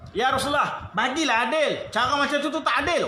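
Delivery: a brash villager talking back; rude complaint. Acted. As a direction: complain bluntly to the Prophet. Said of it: "dia sound Nabi".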